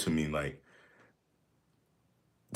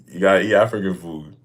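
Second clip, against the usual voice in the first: joking; 5 dB louder than usual. Real, not acted. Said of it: "No, I'm just kidding".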